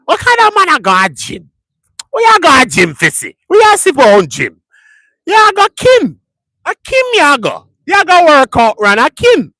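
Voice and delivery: high, loud mock Jamaican rant; Jamaican patois. Imitating Jamaicans arguing about the gym, in a high loud Jamaican accent. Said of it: "So they say".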